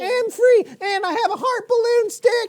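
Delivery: very high squeaky elated voice; joyful Moses. Retold or imitated. Uncle voicing Moses celebrating freedom, giddy. Moses rejoicing after crossing.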